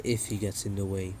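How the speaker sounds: low, soft, cold threat; Nagato's voice. Retold or imitated. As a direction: menace quietly from deep down. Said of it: "Nagato's like".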